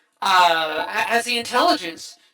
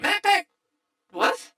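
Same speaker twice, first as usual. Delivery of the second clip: surprised; 11 semitones higher than usual. Real, not acted. Realizing the actor's identity.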